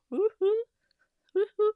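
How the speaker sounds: high, happy cheer; cheering woohoo. Real, not acted. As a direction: celebrate out loud. Celebrating thirty-five thousand members.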